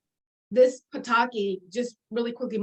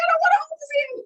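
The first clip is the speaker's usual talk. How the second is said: very high, loud mock protest; retold whiny refusal; 5 dB louder, faster than usual. Kelly refusing Jerry's dating push, high mock protest. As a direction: refuse like a kid, pitched way up.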